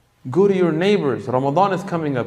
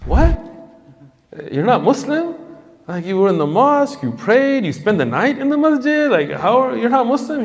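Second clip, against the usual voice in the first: high-pitched, disbelieving questioning, rising at the ends; incredulous; 10 semitones higher than usual. Retold or imitated. Speaker to friend who prayed at the mosque, incredulous. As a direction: react in baffled disbelief, pitch jumping up.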